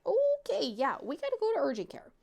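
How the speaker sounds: high, fast alarmed okay; suddenly worried, decide fast; alarmed mother deciding on urgent care. Retold or imitated. Alarm at the watery leg.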